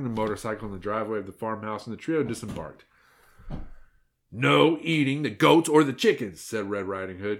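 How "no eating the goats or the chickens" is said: raised, louder stern order; menacing warning. A narrator's reading. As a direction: lay down the law firmly. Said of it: "glaring menacingly at the wolf".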